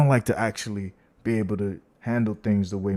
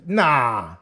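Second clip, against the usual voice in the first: ordinary delivery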